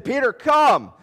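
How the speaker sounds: high, loud summons; calling out as Jesus. Acted. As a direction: call him across the water. Voices Jesus calling Peter.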